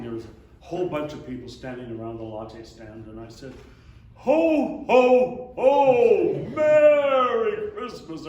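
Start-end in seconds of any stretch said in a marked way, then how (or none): 4.1-8.3 loud, high, booming ho ho ho; jolly Santa call. Pretend. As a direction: boom it out like Santa to the whole crowd. Greeting crowd in Santa hat.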